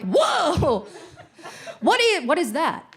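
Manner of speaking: high, mock-surprised playing dumb; feigned innocence. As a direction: act like you've never seen it. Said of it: "I'm like".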